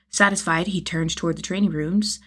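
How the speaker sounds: ordinary delivery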